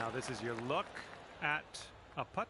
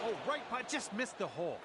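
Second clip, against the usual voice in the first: excited; 8 semitones higher than usual. Real, not acted. Near miss on the putt.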